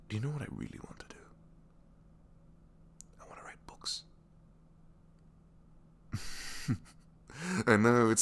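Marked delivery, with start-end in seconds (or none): 0.1-4.0 hushed secret; whispering. Acted. Confessing a secret dream. 6.1-7.0 shy little laugh; embarrassed laugh. Acted.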